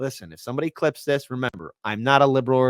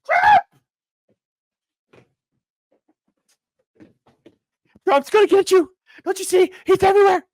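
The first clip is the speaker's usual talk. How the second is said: shrieking, high, loud hysterical panic; liberal panic; 7 dB louder than usual. Acted. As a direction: scream in terror as a panicking liberal. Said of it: "will now reenact a full-on liberal panic".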